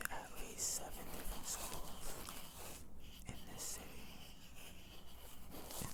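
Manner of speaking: soft close-mic whisper; breathy ASMR whisper, barely voiced; tingly asmr mouth sounds. Long whispered ASMR ramble.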